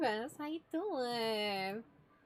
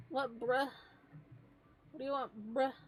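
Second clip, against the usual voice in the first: ordinary delivery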